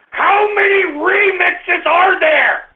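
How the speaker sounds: slow, drawn-out shouted question; high, exasperated demand; exasperated yell. Real, not acted. Exasperated at endless remixes.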